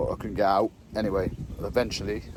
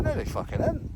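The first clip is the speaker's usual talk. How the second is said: indignant retort. Retold or imitated. Retold row with the bailiff.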